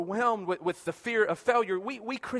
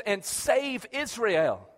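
slow weighty command; much slower than usual. Retold or imitated. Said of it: "He says".

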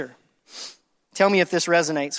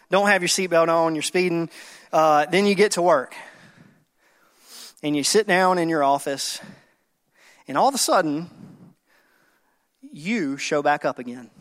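ordinary delivery

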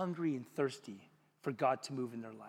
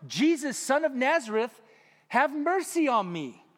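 higher and louder plea, slowing on mercy; crying out; 8 semitones higher, 7 dB louder than usual. A narrator's reading. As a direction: call out desperately for help. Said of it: "began to cry out".

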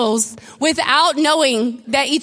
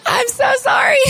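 laughing apology; 9 semitones higher than usual. Retold or imitated. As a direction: squeal sorry while laughing. Embarrassed apology, laughing through it.

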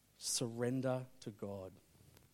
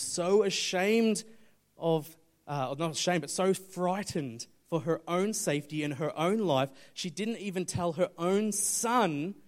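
louder, higher, weighty storytelling; emphatic preaching; 5 semitones higher, 8 dB louder than usual. Real, not acted. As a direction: drive the point home from the pulpit. Preaches at full force.

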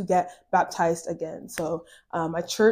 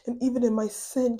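normal talking voice

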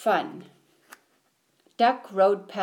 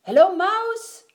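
high, loud greeting; Duck calling out; 10 semitones higher, 5 dB louder than usual. A narrator's reading. Duck greets Mouse while riding, calling out high. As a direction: sing out a high hello as Duck.

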